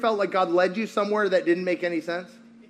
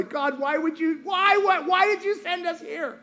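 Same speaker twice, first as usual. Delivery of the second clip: high-pitched exasperated whine; mock wailing plea; 9 semitones higher than usual. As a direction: plead with God in a high, hammed-up wail. Acted plea to God.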